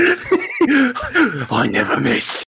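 slow, high, laughing hero boast; mock cocky boast. Mocking the character's boast.

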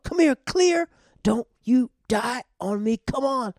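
high, loud mock CPR drama; hammed-up desperate shouting. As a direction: yell like a TV medic reviving a patient. Acting out desperate CPR on dog.

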